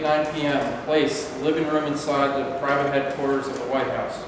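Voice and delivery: ordinary delivery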